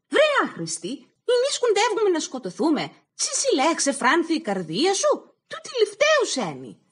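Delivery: high, loud furious scolding of a fool; angry scolding. Acted. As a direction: bark it at him in a rage. Αδέρφια μαλώνουν, τον βρίζουν. (θυμωμένη φωνή, ψηλά και δυνατά)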